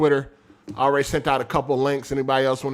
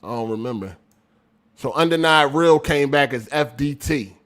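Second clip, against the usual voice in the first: ordinary talk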